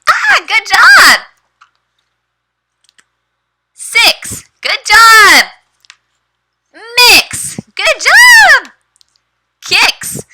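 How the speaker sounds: very high, loud, beaming teacher praise; excited praise. Shown as a demonstration. Teacher praises her young student's reading, very high and excited. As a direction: cheer every answer like a big win.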